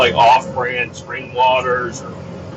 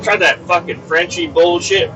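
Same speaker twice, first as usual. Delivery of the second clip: usual speaking voice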